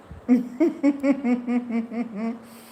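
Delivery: high, loud burst of laughter; big laugh. Real, not acted. Laughing hard at her own story with viewers. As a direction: let a full laugh break out.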